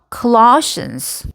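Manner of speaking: Colossians, slow, with LOSS drawn out; stress on second syllable. Shown as a demonstration. Teacher modelling for learners, Colossians stressed on LOSS. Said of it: "이음절에 강세가 있어요".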